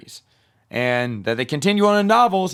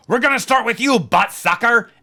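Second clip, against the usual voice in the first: much higher and louder hammed-up vampire cry; booming vampire taunt; 10 semitones higher, 6 dB louder than usual. Vampire pointing at the old lady across the room, loud hammy villain cry. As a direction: point and proclaim it like a theatrical villain. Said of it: "I pointed at the old lady ... and I went".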